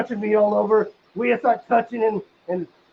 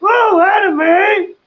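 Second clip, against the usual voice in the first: loud, much higher, slow ooh of joy; thrilled shout; 8 semitones higher, 6 dB louder, much slower than usual. Retold or imitated. Hearing they will watch anime, thrilled slow shout. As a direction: belt it like a fanboy.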